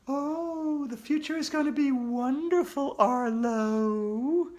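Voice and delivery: high, eerie, falsely sweet voice; sly ghostly reassurance. Acted. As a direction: reassure him slyly as the ghost. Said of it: "assured me".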